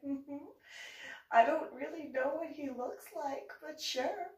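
high polite "mm-hmm, but sure"; humoring. Retold or imitated. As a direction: go along without conviction. Humoring the neighbor.

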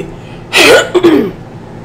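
hard throat clear. Real, not acted. Vlogger apologizing after choking on sauce, clearing her throat. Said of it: "I'm sorry I keep doing that".

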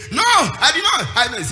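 sharp high shout of denial; defiant denial. Acted. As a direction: snap back in loud protest. Preacher acts defiant denial.

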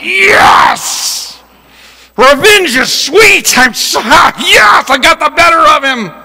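high, drawn-out victory cry; reliving the win, shouted out in glee; triumphant gloating. Retold or imitated. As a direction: crow it like a victorious kid. Retold gloating after hitting his brother.

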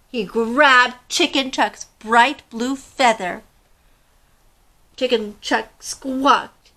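put-on expressive read-aloud to children; storybook reading. Teacher reading a picture book to young listeners, expressive. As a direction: tell the tale to a class of kids.

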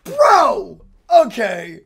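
loud burst, then dropping; impressed shout. Real, not acted. Host impressed by trailer action, impressed shout.

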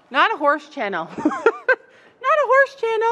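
high squeaky complaint; mock whine. Real, not acted. Vlogger jokes about choosing a horse channel.

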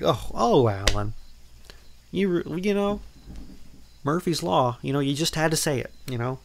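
high, loud, mock exasperation; exasperated teasing. Viewer teasing Alan Grant for jinxing the group, mock exasperated. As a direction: scold Alan for jinxing it, playfully. Mocking Alan's jinx line.

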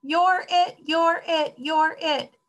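high, repeated tag chant; excited playground shouts. A narrator's reading. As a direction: call it out high, like children chasing. Children's tag cries pile up.

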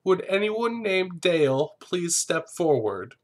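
high, slow formal summons; ceremony announcer. Pretend. Host pretends to run the rose ceremony, slow announcer call. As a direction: call out a drawn-out mock announcement. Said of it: "This rose ceremony is gonna be like".

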